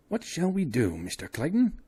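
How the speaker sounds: a worried scholar begging for help; lightly voiced fretful old man; anxious old professor. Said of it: "asked the old professor".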